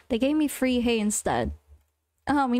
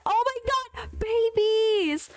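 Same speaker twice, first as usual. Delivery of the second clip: excited squeal; 8 semitones higher, slower than usual. Real, not acted. Spots baby chickens, squeals.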